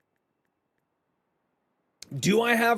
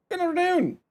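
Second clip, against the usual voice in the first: squeaky high voice; 10 semitones higher, faster than usual. Acted. Varied greetings opening stream.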